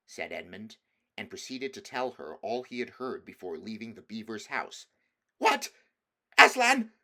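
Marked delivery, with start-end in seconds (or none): 5.4-6.9 shocked witch shriek, high and loud; cried out. Acted. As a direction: recoil at the name. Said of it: "cried the queen".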